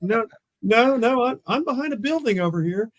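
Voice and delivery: high-pitched retold denial; defensive protest. Officer assures partner he is hidden, defensive protest.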